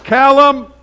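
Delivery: loud, high yell of the other son's name; calling out a name. Retold or imitated. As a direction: holler for the kid to come. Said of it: "And I'm like".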